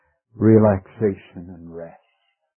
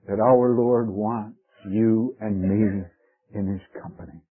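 ordinary delivery

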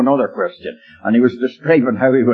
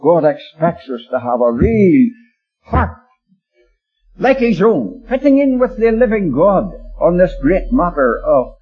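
raised, higher-pitched exhortation; earnest preaching; 7 semitones higher than usual. Real, not acted. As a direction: press it home to the congregation. Preacher exhorts the congregation directly.

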